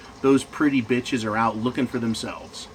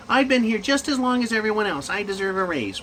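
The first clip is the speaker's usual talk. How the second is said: mock entitled complaint; 7 semitones higher than usual. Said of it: "women are treated like little girls".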